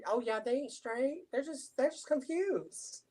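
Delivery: sing-song parody of the son; mocking son. Mocking son's view of straight men.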